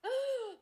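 sharp, high gasp of delight. Real, not acted. Friend hears the book was an anonymous gift, high gasp. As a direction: gasp as if scandalized.

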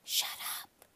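hushed, voiceless whisper; whispered order to be quiet. A narrator's reading. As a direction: whisper it under your breath. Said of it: "I whispered".

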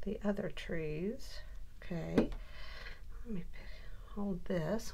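whispered aside to herself; half-voiced whisper while juggling pieces; hushed working aside. Real, not acted. Muttering aside while crafting.

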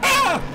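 sharp high loud scream; startled scream. Real, not acted. Player startled again, screaming. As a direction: shriek in fright. Jump scare.

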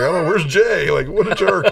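high-pitched, comic indignation; mock exasperation. Man answering the restless group about late Jay, mock-exasperated. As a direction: play up the annoyance at an absent friend.

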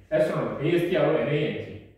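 ordinary talk

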